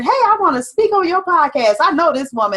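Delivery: high, eager pretend guest; pushy stranger voice. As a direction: play an overeager stranger. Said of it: "she's not one of those special guests that just said".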